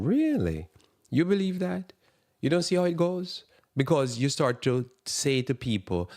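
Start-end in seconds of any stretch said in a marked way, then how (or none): 0.0-3.4 high, pushed disbelief; incredulous scoff. Real, not acted. Preacher mocking the complacent congregation, high incredulous scoff. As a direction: sneer at their naivety, high and pressed.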